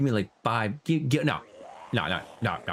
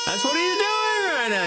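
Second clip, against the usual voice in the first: higher, whimpering mock cry. Player mocking a crybaby with a fake whimpering cry. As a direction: blubber it like a big crybaby. Mock crying about the mob.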